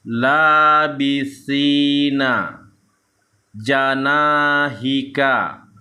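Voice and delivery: slow, drawn-out Arabic syllables on an even tone; measured Quran-reading demonstration; Iqra recitation drill. Shown as a demonstration. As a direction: chant each syllable long and steady. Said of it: "kita akan lanjutkan membaca".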